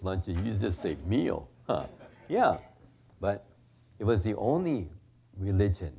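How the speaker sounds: ordinary talk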